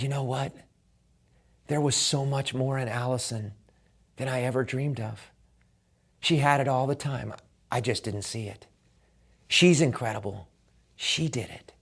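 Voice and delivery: softly, holding back emotion, almost under the breath; tender hush. Retold or imitated. Coach telling the tearful father about his daughter, softly and tenderly. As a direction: speak it gently and quietly to a moved man.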